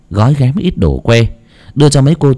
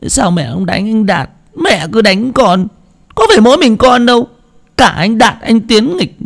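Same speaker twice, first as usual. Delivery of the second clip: put-on whimpering boy; 13 semitones higher than usual. A narrator's reading. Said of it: "ôm đầu mếu máo".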